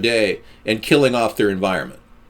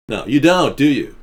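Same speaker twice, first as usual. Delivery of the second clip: ordinary talk, nothing marked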